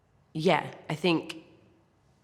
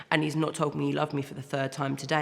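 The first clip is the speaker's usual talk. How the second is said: ordinary talk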